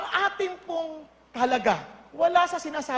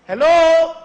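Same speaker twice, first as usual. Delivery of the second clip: loud call-out; 11 dB louder than usual. Real, not acted. Calling out to congregation.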